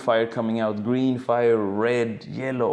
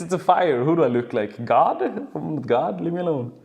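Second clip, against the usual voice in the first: high, loud, laughing bravado; amused carefree rant; 7 semitones higher, 4 dB louder than usual. Retold or imitated. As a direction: shrug off disaster with glee. Edison's mock carefree rant.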